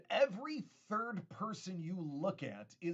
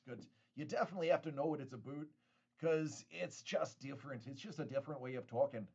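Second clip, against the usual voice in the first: ordinary talk, nothing marked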